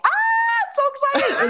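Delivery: very high, laughing squeal; gleeful villain. Retold or imitated.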